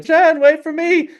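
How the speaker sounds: high, drawn-out call; calling after someone. Acted. Po stuck across the street, high calling out. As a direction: call out to one getting away.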